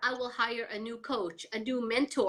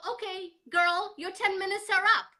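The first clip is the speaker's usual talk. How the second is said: brisk self pep talk; 7 semitones higher than usual. Retold or imitated. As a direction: cheerfully tell yourself time's up. Self pep talk ending pity.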